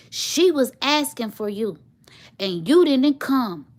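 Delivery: mock the sister's angry scolding; sister's accusation. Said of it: "And she was like".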